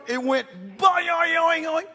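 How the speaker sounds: high bouncing boing, boing; springy boing sound effect. Real, not acted. Preacher mimicking the arm snapping back, boing boing. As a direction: make the arm spring like a coil.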